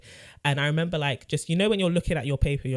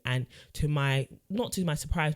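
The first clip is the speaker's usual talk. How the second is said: normal talking voice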